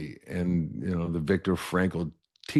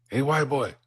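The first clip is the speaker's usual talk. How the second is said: higher, persistent call across the hallway; 6 semitones higher than usual. Retold or imitated. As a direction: keep calling him, patiently insistent. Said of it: "he just persisted every day".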